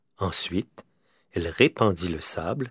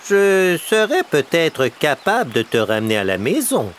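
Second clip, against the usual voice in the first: high, louder crow voice; the helpful crow, pitched up; crow offering help; 8 semitones higher, 6 dB louder than usual. A narrator's reading. Crow offering to bring the bear home, high and bright. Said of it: "dit Corneille".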